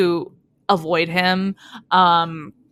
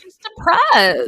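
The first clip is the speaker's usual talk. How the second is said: cooing sympathetic; 11 semitones higher, slower than usual. Real, not acted. Cooing about sad heroine.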